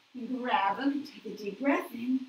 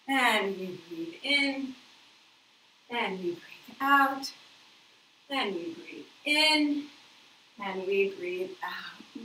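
slow guided breath; 6 dB louder, slower than usual. Shown as a demonstration.

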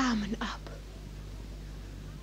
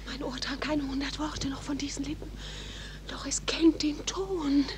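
quick breathy whisper, much softer; fast whisper; 9 dB quieter than usual. Acted. Juliet to Romeo under the balcony, whispering. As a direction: rush it out under your breath. Secret talk at night on balcony.

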